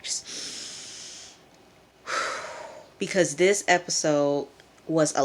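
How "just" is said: long breathy exhale, then another; deep sighs. Real, not acted. Overwhelmed reviewer sighing before recapping the episode. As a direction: let the air out slowly, twice. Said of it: "you just gotta take a deep breath".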